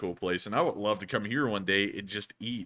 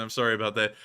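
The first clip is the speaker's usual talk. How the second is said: ordinary talk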